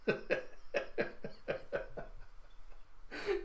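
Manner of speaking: long, high, soft mock-villain cackle; maniacal laugh. Villain escaping on his crane, high maniacal cackle.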